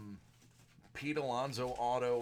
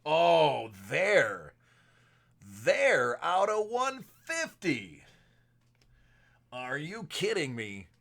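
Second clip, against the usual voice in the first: excited; 3 semitones higher, 7 dB louder, slower than usual. Real, not acted. Big card pulled on stream.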